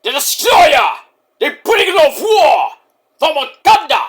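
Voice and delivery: loud, high booming announcement of titles; shouted mock-heavyweight roar; Oba-style wrestler. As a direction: proclaim each title like a menacing giant. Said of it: "He sounds like".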